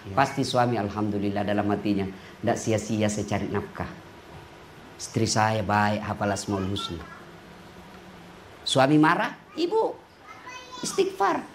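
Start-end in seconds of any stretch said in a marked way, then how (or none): 0.1-7.0 low, soft, contented musing; husband's inner thoughts. Pretend. Grateful husband thinking about his devout wife, low and soft. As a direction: think it quietly to yourself, much lower.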